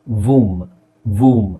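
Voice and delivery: ವೂಮ್ low, with a long oo; silent b. Shown as a demonstration. Teacher models womb, low, b left silent. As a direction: say ವೂಮ್ ending on m, no b. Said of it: "ಬಿ silent".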